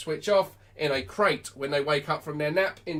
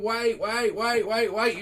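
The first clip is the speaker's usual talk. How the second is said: higher, urgent repeated command; struggling owner; 5 semitones higher, slower than usual. Acted. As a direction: mimic an owner holding back a dragging dog. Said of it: "Then you're holding the dog back, going".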